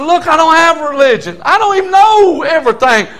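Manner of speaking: rising shout of testimony; preaching shout. Acted. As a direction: cry it out to the congregation.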